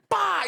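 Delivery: loud, high "pá!"; sudden explosive smack noise; imitated belt snap. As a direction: imitate a doubled belt cracking. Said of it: "E dobrava e".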